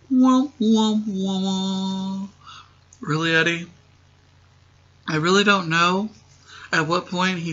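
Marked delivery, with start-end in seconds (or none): 0.1-2.3 mock sad trombone. Mocking Trevor's failed pick-up, sad-trombone wah-wah. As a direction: imitate a sad trombone on a held pitch.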